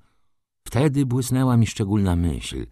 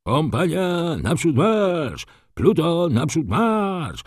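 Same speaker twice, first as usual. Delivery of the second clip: high, drawn-out marching orders; slow, high parade-ground calls; officers' repeated commands; 9 semitones higher, slower than usual. A narrator's reading. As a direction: call the order along to your unit, long vowels. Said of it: "powtórzyli różnymi głosami oficerowie".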